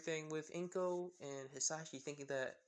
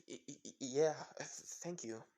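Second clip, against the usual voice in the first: nervous stammer. Acted. Awkward fallen boy answers a girl offering help, stammering nervously. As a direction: stumble haltingly into a small thanks.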